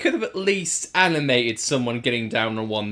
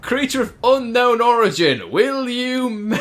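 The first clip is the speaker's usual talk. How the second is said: high, loud and slow theatrical proposal; grand mock proposal; 8 semitones higher, 6 dB louder, slower than usual. Proposing to the creature on a sunset beach, hammed-up grand. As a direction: propose like a hammy romantic hero.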